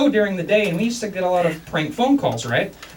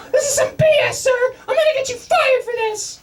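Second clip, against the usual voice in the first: outraged wife. Retold or imitated. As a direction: threaten him, pitched way up. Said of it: "His wife calls back".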